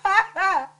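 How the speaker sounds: loud scream breaking into sobs; open-throated wail of grief; anguished scream. Acted. Woman seeing bodies on TV, anguished scream. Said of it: "I just scream".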